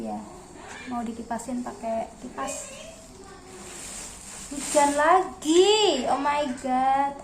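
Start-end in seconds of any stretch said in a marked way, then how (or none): 4.5-6.0 high, slow dragged-out complaint; exasperated. Real, not acted. Rain again, repeated twice. 6.1-7.1 exasperated groan. Real, not acted. Rain again, complaining to viewers.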